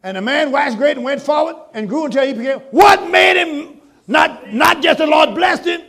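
slow, high, loud shouted preaching; full-force preaching. Real, not acted. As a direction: stretch every word and thunder it.

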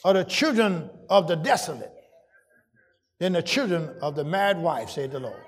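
low, soft reading of the verse; hushed Bible reading; scripture reading. Acted. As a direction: read the passage quietly before building up. Reads scripture before preaching.

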